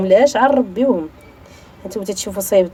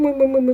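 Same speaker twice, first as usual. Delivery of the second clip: cooing call to cat; 7 semitones higher than usual. Real, not acted. Calling the cat anxiously.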